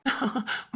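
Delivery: quiet, pleased little laugh; soft chuckle. Acted. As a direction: chuckle softly into the phone. Pleased caller chuckles softly. (மெல்லிய சிரிப்பு)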